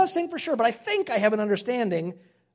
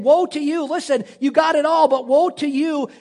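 Jesus raising his voice, louder and higher; louder urgent warning; 5 semitones higher, 5 dB louder than usual. Acted. Jesus warning the rich crowd, louder and urgent. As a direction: call it out as a pressing warning. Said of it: "Jesus is saying".

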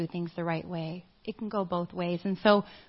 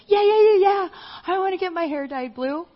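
put-on much higher and louder, eager young girl; eager girl volunteer; 10 semitones higher, 5 dB louder than usual. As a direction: bounce in like an excited kid begging for it.

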